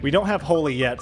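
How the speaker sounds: ordinary talk, nothing marked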